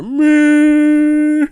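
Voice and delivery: long, high, loud held wail; mock crying wail. Mocking a tripping commentator, loud drawn-out cry. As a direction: wail one syllable like a comic cry. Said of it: "best cry ever".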